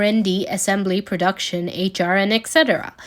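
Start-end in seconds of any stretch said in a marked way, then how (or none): none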